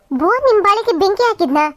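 ordinary delivery

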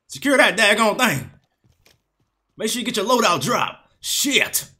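a hint of the announcer's nagging; parroting the game's announcer; Graves announcer impression.

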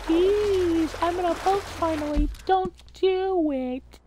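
high pleading. Acted. Begging in game.

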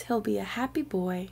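usual speaking voice

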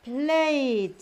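Played with a long, held ay; long vowel sound. Shown as a demonstration. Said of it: "that is its sound".

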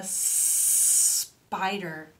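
SPIDER with a long hissed S; long snake sound. Shown as a demonstration. Teacher prompts children in the story, long S. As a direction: stretch the first S of spider slowly.

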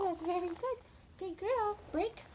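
bright, high-pitched praise for the puppy; high dog praise. Real, not acted. Trainer rewards her puppy with high praise.

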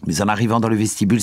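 usual speaking voice

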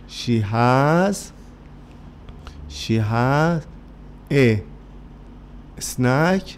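slow, drawn-out words with pauses between; word-by-word modelling. Shown as a demonstration. Teacher feeding the class "she has a snack", word by word. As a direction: dictate it in pieces, very slowly.

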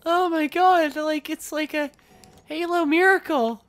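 high, loud fan excitement; bursting with excited disbelief; ecstatic amazement. Real, not acted. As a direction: proclaim it in high-pitched joy. Overjoyed at the editing options.

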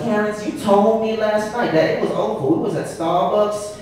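high, sassy girlfriend impression; Bon Qui Qui. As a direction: put on a complaining sassy woman's voice. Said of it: "her voice changed to one akin to, like, Bon Qui Qui".

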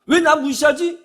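high, loud offended question; acted resentment. Preacher voicing listeners' angry thought, offended protest. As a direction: question it like someone stung. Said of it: "이런 감정이 들어서 화가".

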